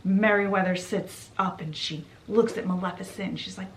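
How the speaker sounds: usual speaking voice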